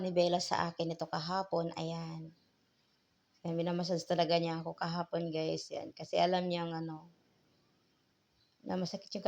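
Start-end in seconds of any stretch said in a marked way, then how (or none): none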